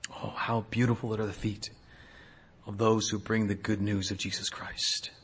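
soft, low and reverent; hushed awe. Acted. Preacher marvels at the feet of those bringing good news, hushed awe. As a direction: marvel quietly, voice dropped. Awed quote after forceful preaching.

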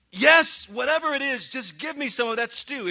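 high, loud, hasty agreement; hammed-up desperate yes; overdramatic impatience. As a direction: agree impatiently, just wanting the food. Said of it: "he overreacts in his passions".

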